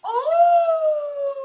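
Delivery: a high, loud "Oh!" of real delight; delighted surprise. Real, not acted. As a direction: gasp out the joy of seeing the gift. Gift revealed, delighted cry.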